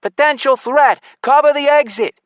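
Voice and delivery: high, loud urgent orders; shouted alarm. A narrator's reading. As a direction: shout commands to the guards. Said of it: "the elf suddenly shouted into the signal amulet".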